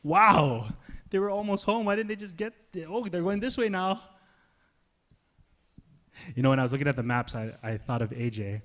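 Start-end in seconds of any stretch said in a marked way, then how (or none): 0.0-4.0 mock amazement. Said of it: "It just looks".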